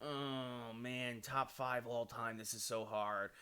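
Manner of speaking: very low, hushed thinking aloud; musing to himself. Real, not acted. Streamer weighing his top five movies, quiet low murmur. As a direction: murmur it while searching for an answer. Musing over a hard question.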